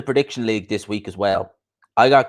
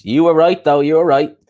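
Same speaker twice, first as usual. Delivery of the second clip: louder, higher burst of credit; emphatic concession; 6 semitones higher, 6 dB louder than usual. Real, not acted. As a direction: concede it loudly, pitch up. Emphatically conceding a lost prediction.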